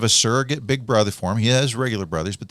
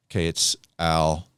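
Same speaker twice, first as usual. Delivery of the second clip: low, mildly reluctant admission; retold embarrassed reluctance; 5 semitones lower than usual. Embarrassed guest giving in to the host, imitated reluctance. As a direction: give up the name reluctantly, pitched down. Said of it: "he's a little embarrassed".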